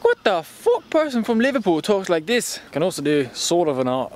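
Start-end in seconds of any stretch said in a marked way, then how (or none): 0.0-2.6 put-on high-pitched, indignant Irish accent. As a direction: protest in a mock Irish accent. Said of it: "I was putting on this Irish accent".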